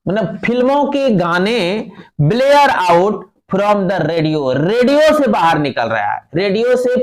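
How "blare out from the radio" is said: very slow, word by word; slow reading aloud. Shown as a demonstration. Teacher reading the textbook line to students, very slow. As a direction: spell out each word for the class. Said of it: "teacher reads text aloud slowly".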